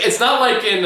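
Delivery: ordinary talk, nothing marked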